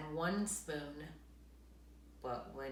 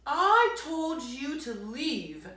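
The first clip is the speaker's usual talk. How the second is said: slow, louder angry bear; bear crying out; 6 semitones higher, 6 dB louder, slower than usual. Acted. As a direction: drag out the scolding. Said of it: "cried Bear".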